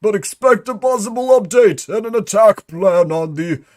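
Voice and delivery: Employee Fifty-Three voice. Acted. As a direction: read it out theatrically, pitched well up and projected. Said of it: "I had to read that in a different voice".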